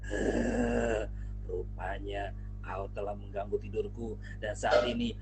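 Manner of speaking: low, threatening lion growl; menacing lion. Acted. Singa mengancam akan memakan tikus. (singa mengancam tikus, suara rendah menggeram)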